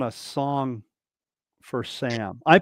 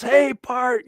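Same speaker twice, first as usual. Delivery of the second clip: Greg's greeting pitched up high; imitating Greg; 9 semitones higher, 4 dB louder than usual. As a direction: do Greg saying hi, brighter and higher. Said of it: "You know how he is".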